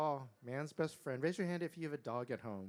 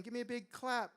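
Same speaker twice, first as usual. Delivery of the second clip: usual speaking voice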